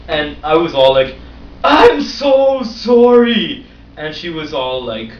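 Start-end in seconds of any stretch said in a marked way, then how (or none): 1.6-3.7 mock apology. Apologizing in embarrassment.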